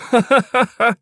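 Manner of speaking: high giggling laugh; gleeful laugh. Acted. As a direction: laugh at the prank. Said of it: "he laughed".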